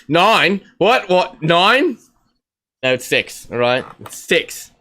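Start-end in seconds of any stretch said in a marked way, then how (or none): 0.1-2.0 loud, high, baffled questions; acted confusion. Imitating people reading the hand sign wrong, loud mock confusion. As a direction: exclaim in mock bewilderment, pitched up. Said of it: "be dyslexic and be like".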